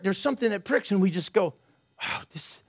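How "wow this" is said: whispered, awestruck aside; hushed wonder. Acted. A preacher voices a new believer's first stirring, hushed wonder. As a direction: breathe it softly, as a private realisation.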